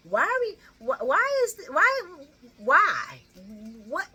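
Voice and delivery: high-pitched exasperated sputter; sputtering, pitch climbing in disbelief; can't believe what she's watching; exasperated rant. Real, not acted. Ranting at soap characters.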